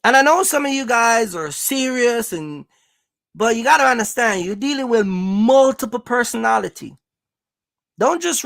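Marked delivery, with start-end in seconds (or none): none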